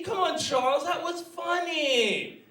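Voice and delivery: high, slow wheedling; unfunny pleading friend. Retold or imitated. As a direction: mimic a needy joker begging for a laugh. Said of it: "but he comes to me".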